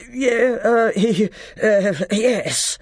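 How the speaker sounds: high, loud, breathless stammer; panting. A narrator's reading. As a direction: fight for breath between broken syllables. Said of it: "panted the dwarf, for he had not yet got his breath back".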